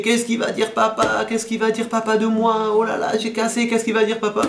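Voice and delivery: plainte d'enfant en pleurs, aiguë et monocorde; enfant qui crie. Retold or imitated. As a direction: imiter un gamin qui se lamente, voix haut perchée. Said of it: "il entend l'enfant crier". (enfant en pleurs, lamentation imitée)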